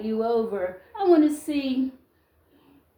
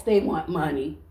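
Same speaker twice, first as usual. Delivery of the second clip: ordinary talk, nothing marked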